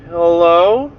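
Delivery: questioning hello, sharply rising; wary boy. Retold or imitated. Boy walks up to the closet door, wary rising call. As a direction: imitate a boy checking who is there. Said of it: "and he's like".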